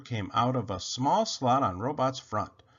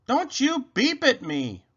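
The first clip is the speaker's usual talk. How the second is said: high, slow, indignant holler; 11 semitones higher, 4 dB louder than usual. A narrator's reading. Rabbit snaps back at beeping Robot, high slow holler. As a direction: holler each word high. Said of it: "hollered Rabbit".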